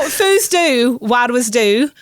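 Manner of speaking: "fus du" said very slowly, then "where was du?" rising; Shetland phrase. Shown as a demonstration. Guest slowly repeating the Shetland phrase for the hosts, careful demo. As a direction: spell out the Shetland words for listeners, slow and clear. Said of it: "if that was me up in Shetland".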